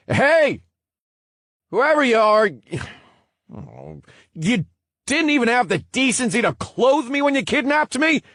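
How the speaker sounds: high, loud indignant yell; shouting. Acted. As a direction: shout at an empty room. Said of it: "he shouted at nothing in particular".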